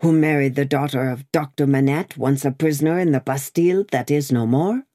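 ordinary delivery, nothing marked

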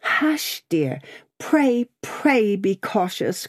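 high, hushing acted entreaty; gently pleading young lady's voice; anxious plea. A narrator's reading. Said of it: "cried Lucy".

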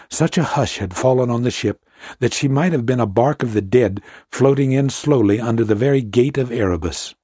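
usual speaking voice

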